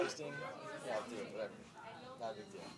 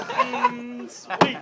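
squeaky high laugh through the words; cracking up mid-line; 12 semitones higher, 13 dB louder than usual. Real, not acted. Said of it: "Why are you not reading this to everyone?".

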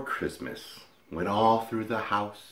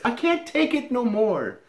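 high, exasperated wife voice; shrill-pitched plea from up the ladder; wife on a ladder demanding the mouse be caught, high and exasperated; wife fed up; 8 semitones higher, faster than usual. Acted. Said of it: "she says".

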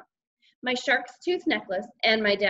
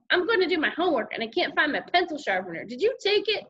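quick, higher, pestering demand; accusing sister; 7 semitones higher than usual. A narrator's reading. Sister wanting her pencil sharpener, accusing. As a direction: rush it out, rising high on the question.

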